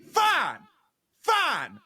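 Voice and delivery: hammed-up angry heckler leaving; drunk heckler storming off. Drunk heckler announcing he'll leave, high mock shout. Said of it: "stands up and goes".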